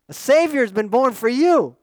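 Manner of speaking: loud, high announcement; excited proclamation. Acted. Shepherds telling people what they heard, excited proclamation. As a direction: call the great news out to everyone. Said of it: "That's what they went and told people".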